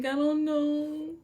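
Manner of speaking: high, slow, frightened denial; panicking. Retold or imitated. Student tells the principals, high and frightened. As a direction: plead it, close to tears.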